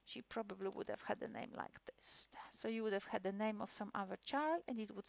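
murmured to herself; muttering while waiting for the site; whispered aside. Real, not acted. Aside while the page loads.

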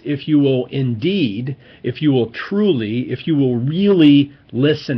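"really" punched loudest in "if you will really listen"; emphatic reading. Shown as a demonstration. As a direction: stress building to "really", slow and insistent. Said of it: "It's emphatic language".

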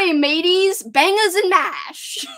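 loud, high, hammed-up British accent; mock British accent. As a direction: call it out like a cartoon Brit. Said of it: "[name] is British now".

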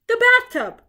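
loud, high exclamation; cried out. A narrator's reading. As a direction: cry it out in triumph. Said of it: "cried Sherlock".

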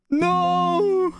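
sudden high cry of dismay; alarmed shout. Real, not acted. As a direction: yelp as the app crashes. App crashes on stream.

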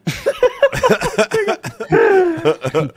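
high-pitched burst of laughter. Real, not acted. Lautes Lachen über Witz. (hohes Lachen)

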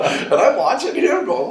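ordinary talk

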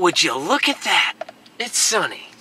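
lightly voiced viral-video catchphrase; a hint of the meme voice; quoting a viral video. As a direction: mimic the guy from the funny video. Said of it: "I know y'all have seen that video".

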